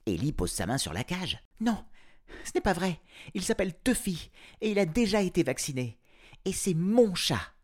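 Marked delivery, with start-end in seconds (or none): 1.6-7.5 put-on high, insistent girl; girl's voice. A narrator's reading. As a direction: argue for your cat. Girl argues.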